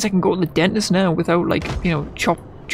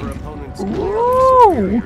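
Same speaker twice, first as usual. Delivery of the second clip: high startled whoa; surprised. Real, not acted. Startled by bone body.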